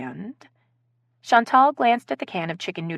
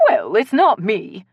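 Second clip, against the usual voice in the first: put-on slow, louder English-accented denial; 3 semitones higher, 5 dB louder, slower than usual. A narrator's reading. Accused guest backs away from suspicion, English accent. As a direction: deny it slowly in an English accent. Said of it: "with an English accent".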